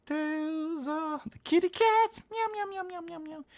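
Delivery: high squeaky meowing; cat voice. Acted. Showing off the kitty cats with high meows.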